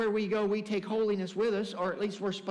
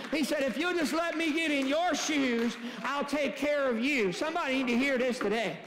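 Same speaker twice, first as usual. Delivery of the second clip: higher-pitched preaching, building to a sustained shout; full-force preaching; 5 semitones higher than usual. Real, not acted. Preacher urges congregation to get God in their shoes, building to impassioned preaching. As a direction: drive it up to a ringing climax.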